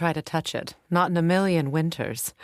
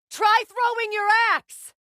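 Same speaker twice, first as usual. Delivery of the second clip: high, loud battle call; urgent shout; 4 dB louder than usual. Acted. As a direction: push him to use the arrows. Combat advice shouted.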